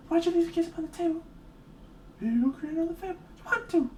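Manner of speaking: squeaky high put-on voice, quick; silly high voice. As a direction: goof around in a squeaky high voice. Silly voice bit while painting.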